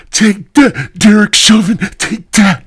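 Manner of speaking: high, loud, punchy yelling; aggressive shout. Acted. Overheard man yells at Derek Chauvin mid-act, aggressive shout. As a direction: shout it in bursts, like landing blows.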